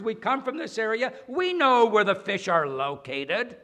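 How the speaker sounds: huffy complaint of a tired fisherman; annoyed fisherman. Acted. Preacher voices annoyed fishermen's thoughts.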